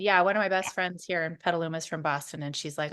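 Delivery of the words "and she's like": ordinary talk, nothing marked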